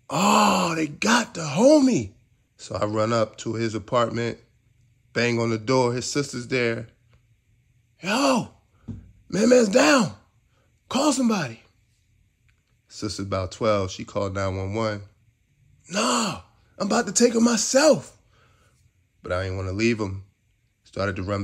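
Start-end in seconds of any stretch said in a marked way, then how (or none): 0.1-2.1 high, loud anguished cry; shocked cry. Retold or imitated. Finds friend's cut throat. 8.0-11.6 high, loud urgent call for help; urgent shout. Retold or imitated. Banging on sister's door. 15.9-18.2 high, loud determined shout; urgent shout. Retold or imitated. Refuses to wait.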